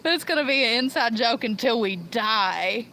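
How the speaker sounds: normal talking voice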